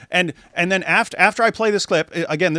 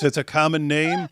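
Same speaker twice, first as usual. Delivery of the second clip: ordinary talk, nothing marked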